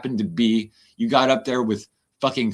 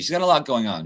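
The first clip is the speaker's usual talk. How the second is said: ordinary talk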